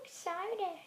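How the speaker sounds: mock excited dog. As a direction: gush like an eager puppy. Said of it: "And the dog says".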